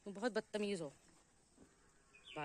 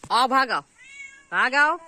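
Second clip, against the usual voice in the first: loud, higher shout to hurry; calling out; 6 semitones higher, 12 dB louder, slower than usual. Real, not acted. Owner urges the straying pet to run back, loud call.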